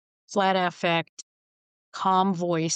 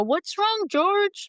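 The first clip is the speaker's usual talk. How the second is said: much higher, fussing mother voice; elderly mother; 13 semitones higher, much slower than usual. Retold or imitated. Grandmother bending down to George, higher doting voice. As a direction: coo at the boy like a doting grandma. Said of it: "she'd bend down and go".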